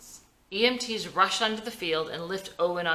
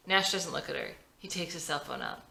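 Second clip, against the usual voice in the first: usual speaking voice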